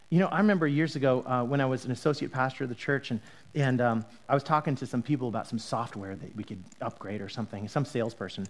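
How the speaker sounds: ordinary talk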